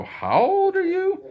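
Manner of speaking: mock the young players' squeaky question; hammed-up youngster on voice chat; young gamer asking. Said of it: "They go".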